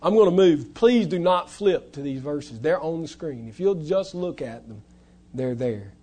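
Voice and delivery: usual speaking voice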